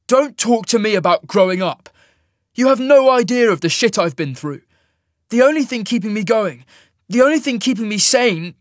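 loud, high-pitched angry tirade; furious outburst. A narrator's reading. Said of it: "the fury in his grey eyes".

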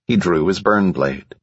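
ordinary delivery

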